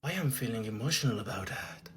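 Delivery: low, soft teary mock of Cynthia; a hint of a weepy actress; imitating Cynthia. Mocking the actress answering an interview, soft and teary. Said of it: "Cynthia, elle répond".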